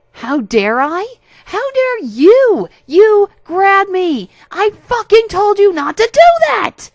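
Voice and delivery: very high, very loud, slow rage; furious screaming. Acted. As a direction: scream every word at him. Narrator screams at harasser.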